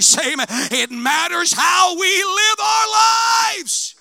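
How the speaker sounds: loud, high, fast preaching shout; full-force preaching. Acted. Preacher demanding amen from the church, shouting. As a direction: drive it home to the congregation.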